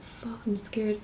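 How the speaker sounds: hushed, breathy whisper of fright; scared whisper. Retold or imitated. Whispering to oneself alone in the theater, scared whisper. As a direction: whisper it to yourself, spooked.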